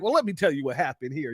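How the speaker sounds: a light parody of a calm explanation; mock sober explainer. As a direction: rattle it off like you suddenly sobered up. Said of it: "It was like".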